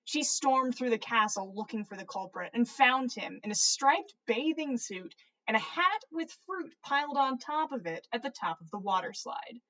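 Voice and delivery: ordinary delivery, nothing marked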